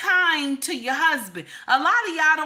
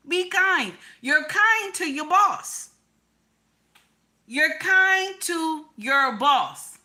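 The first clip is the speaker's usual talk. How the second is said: ordinary talk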